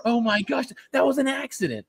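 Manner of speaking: high, hammed-up dismay; mock appalled. Golfer after knocking down a nest, high mock dismay. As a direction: imitate a golfer protesting his innocence.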